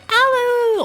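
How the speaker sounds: very high, hooting British greeting; mock British accent. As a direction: sing out a squeaky mock British hello. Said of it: "the British thing".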